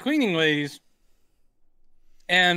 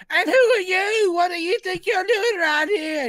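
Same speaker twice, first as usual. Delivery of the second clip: high, screeching indignant falsetto; Python-style drag shriek; scroungy cleaning lady; 3 dB louder than usual. Retold or imitated. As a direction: challenge them in a squawking charlady voice. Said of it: "and they're like".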